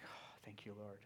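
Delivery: breathy relieved whisper of thanks. Retold or imitated. Father thanking God at his son's bedside, whispered. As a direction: whisper a grateful prayer. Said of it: "I was like".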